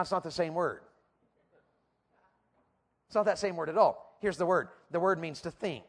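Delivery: normal talking voice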